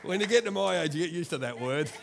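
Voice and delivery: loud laughing talk. Real, not acted. Preacher laughing about his age at his slip, loud and laughing.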